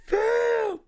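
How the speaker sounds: ordinary talk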